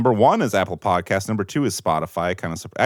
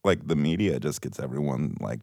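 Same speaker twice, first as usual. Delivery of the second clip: vocal fry; 5 semitones lower, 5 dB quieter than usual. Shown as a demonstration. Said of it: "I'm gonna get some vocal fry going on".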